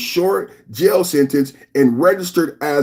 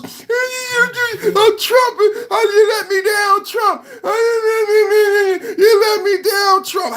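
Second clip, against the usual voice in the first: mock wailing crybaby; slower than usual. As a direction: wail it like a spoiled crybaby. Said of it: "crying on conservative media".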